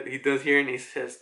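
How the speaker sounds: ordinary talk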